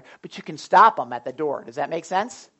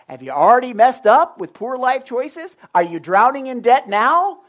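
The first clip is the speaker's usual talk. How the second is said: loud, higher sarcastic sales voice, laughing at the end; mock ad pitch; 5 semitones higher, 9 dB louder than usual. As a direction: ham up a radio ad's true message. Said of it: "what they're saying is".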